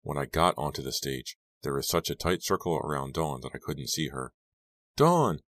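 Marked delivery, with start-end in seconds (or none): 5.0-5.4 high, loud desperate calls; desperate calling. A narrator's reading. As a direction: call out frantically for her. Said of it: "I called, desperate to know".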